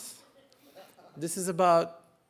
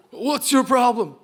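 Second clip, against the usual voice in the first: loud, higher-pitched mock challenge; acted confrontation; 6 semitones higher, 8 dB louder than usual. Turkish teen challenging the French kid, mock-angry shout. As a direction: call him out, hammed-up and loud.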